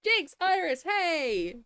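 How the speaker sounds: high, bright hello to friends; excited greeting. Real, not acted. Greeting chat friends Jinx and Iris, high and excited. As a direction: call out their names happily.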